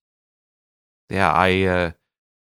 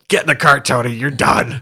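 louder, higher retold coach's order; angry coach's shout; 4 semitones higher, 5 dB louder than usual. Golf coach catching a player throwing his bag, shouting. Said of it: "was the golf coach".

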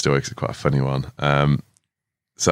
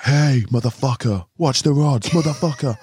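imitated angry shout; 9 semitones higher than usual. Gasper warning tourists off the fishing rods, imitated shouting. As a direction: act out an irate warning from the bank. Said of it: "this Slovenian man shouting at me".

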